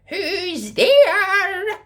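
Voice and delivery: put-on high, loud quavering old lady; old grandma calling. A narrator's reading. Grandma calling through the door, high and quavering. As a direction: call out like a frail granny. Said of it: "called Grandma".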